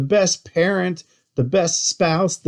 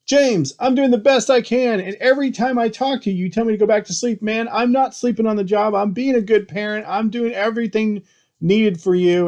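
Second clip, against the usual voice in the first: higher, pressed retelling of telling off his lawyer; mildly heated retold outburst at the attorney; retold confrontation; 7 semitones higher than usual. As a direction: replay the heated complaint, half in earnest. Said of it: "got in his face and said".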